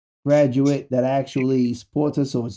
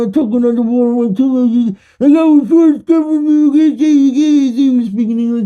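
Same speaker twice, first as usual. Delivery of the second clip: garbled talk; 7 semitones higher, 7 dB louder than usual. Acted. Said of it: "Was I even speaking fucking English?".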